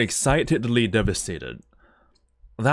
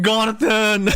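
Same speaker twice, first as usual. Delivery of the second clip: high, loud shout of the name; a sudden cry of joy; excited shout; 10 semitones higher, 5 dB louder than usual. Real, not acted. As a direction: yell it out in delight.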